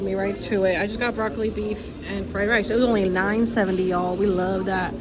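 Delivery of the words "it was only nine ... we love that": usual speaking voice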